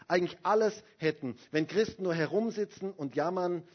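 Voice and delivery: ordinary delivery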